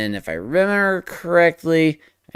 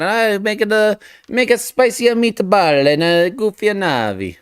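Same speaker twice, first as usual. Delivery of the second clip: put-on playful Italian accent, a touch higher; cheesy Italian chef accent, lightly done; mock Italian accent; 8 semitones higher than usual. Artist joking to viewers in a hammed-up Italian accent. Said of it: "I went to Italian there".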